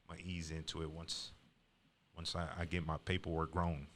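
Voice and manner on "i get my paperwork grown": ordinary delivery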